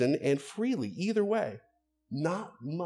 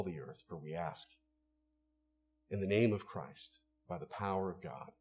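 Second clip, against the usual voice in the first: closing prayer; 3 semitones lower than usual. Acted. Pastor leading the congregation in prayer, hushed and earnest. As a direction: pray it low and earnest.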